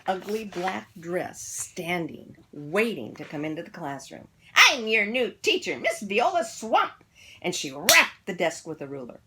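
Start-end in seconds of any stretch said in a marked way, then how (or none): 4.6-7.0 loud, higher, slowed announcement; stern witchy teacher. A narrator's reading. Miss Viola Swamp introducing herself to kids, stern and loud.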